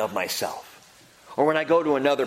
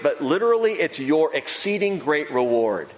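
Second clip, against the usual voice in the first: ordinary talk, nothing marked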